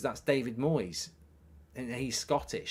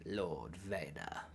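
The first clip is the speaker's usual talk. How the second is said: very soft, low, slow croak; hushed, drawn-out dark lord impression; Palpatine; 7 semitones lower, 11 dB quieter, much slower than usual. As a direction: intone each phrase low with long pauses. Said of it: "I can do it like this".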